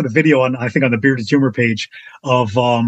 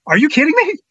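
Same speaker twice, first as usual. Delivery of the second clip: high-pitched acted disbelief; retold outrage, voice shooting up; incredulous; 12 semitones higher than usual. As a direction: imitate a friend's exasperated disbelief. Retelling friend's incredulous reaction.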